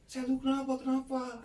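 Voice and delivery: very high, held-back mock yell; retold alarmed cry. Girl calls to her strange-acting friend, imitated high scream. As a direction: mimic a panicked call without the volume. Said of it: "langsung teriak".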